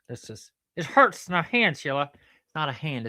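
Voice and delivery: normal talking voice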